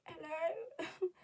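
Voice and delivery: high croaky just-woken hello; groggy hello. Retold or imitated. Sleepy vlogger answering the door, high groggy hello. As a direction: sound freshly woken and dazed. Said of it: "just woke up and I'm like".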